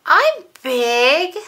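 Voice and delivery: put-on high, loud little-girl protest; insistent little sister. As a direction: stamp your foot, you're big too.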